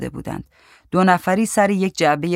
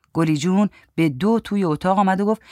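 ordinary delivery

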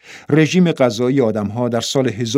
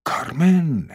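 کارمن said fuller, rising then falling; savouring; 3 semitones higher, slower than usual. A narrator's reading. Man delights in the woman's fitting name, savouring it. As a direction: relish the name کارمن, a little brighter in pitch.

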